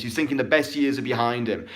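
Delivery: ordinary delivery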